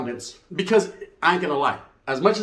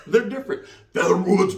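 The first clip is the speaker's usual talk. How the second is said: ordinary talk, nothing marked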